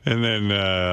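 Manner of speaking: ordinary talk, nothing marked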